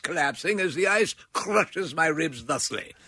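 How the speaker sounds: drowning Victorian gent with absurdly composed, proper English; hammed-up politeness while supposedly dying, pitched a little up; mock stiff-upper-lip victim. Drowning man politely addressing bystanders, mock stiff upper lip. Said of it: "Even while they're panicking, they have wonderful English".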